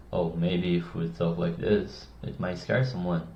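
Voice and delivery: deep, soft, potentially scary voice; serious low tone. Shown as a demonstration. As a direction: speak low and grave, enough to unsettle a child. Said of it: "this is a serious tone of voice".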